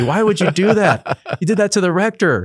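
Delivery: half-laughing, tease him for pranking the rector; mock scolding. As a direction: fellow seminarians scold the prankster, high and laughing. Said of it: "were telling me".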